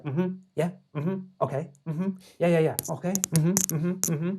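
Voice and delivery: string of quick listener's murmurs and yeahs; imitating listening noises. Dalai Lama hearing out the pitch, imitated acknowledgements. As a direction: mimic someone nodding a speaker along. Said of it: "as I'm talking like this".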